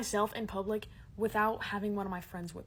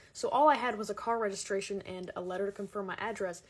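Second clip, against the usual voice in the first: ordinary talk, nothing marked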